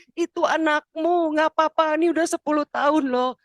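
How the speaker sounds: high, insistent plea to God; acted desperate prayer, pitched high; pleading prayer. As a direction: beg God loudly with rising urgency. Acting out desperate prayer in cave.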